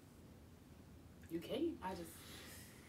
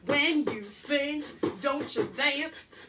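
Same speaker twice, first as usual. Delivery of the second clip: slow rapped line in a Virginia accent; 3 dB louder, slower than usual. Real, not acted. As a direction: stretch the words out with a Virginia twang. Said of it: "you got a Virginia accent right now".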